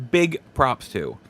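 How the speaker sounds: usual speaking voice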